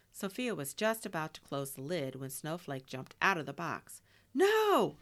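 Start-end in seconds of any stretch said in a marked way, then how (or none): 4.3-5.0 high, loud scream of alarm; storybook scream, pitched way up. A narrator's reading. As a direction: cry out in sudden panic. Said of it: "Sophia screamed".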